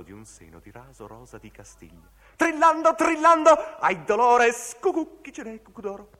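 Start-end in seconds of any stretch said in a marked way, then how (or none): 2.4-6.1 very high and loud, like a bird trilling; shrill trilling call. Acted. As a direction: pitch it up like a cuckoo. Sung-out trilling cuckoo words. (trillo acutissimo e forte)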